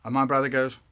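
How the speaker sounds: usual speaking voice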